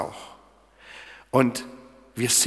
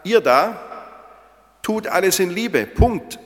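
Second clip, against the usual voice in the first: blunt command; 5 semitones higher, 5 dB louder than usual. Pretend. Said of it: "wieso sagt Paulus nicht ganz klar".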